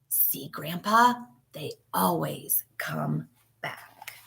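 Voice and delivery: hushed, slow whisper to grandpa. Acted. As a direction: whisper so no one hears you. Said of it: "and whisper".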